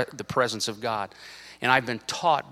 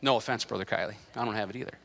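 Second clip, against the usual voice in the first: ordinary talk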